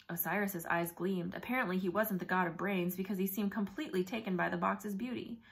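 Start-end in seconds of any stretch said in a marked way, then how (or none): none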